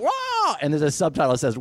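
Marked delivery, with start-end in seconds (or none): 0.0-0.6 high squawk cry; mock eagle screech.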